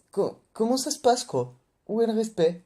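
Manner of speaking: hammed-up scandalised protest; mock outrage. Reviewer scandalised by Nami's disrespect, high hammed-up indignation.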